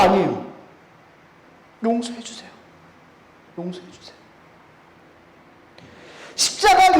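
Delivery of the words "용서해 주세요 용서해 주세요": hushed prayer, dropping away softer; soft imitated plea. Jesus on the cross asking forgiveness for persecutors, hushed plea. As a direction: plead very softly, as if in prayer.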